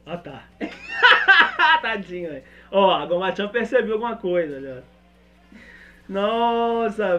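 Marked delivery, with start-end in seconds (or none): 0.6-1.8 shrill burst of giggles; high squeal laugh. Real, not acted. Reação animada. (risada aguda)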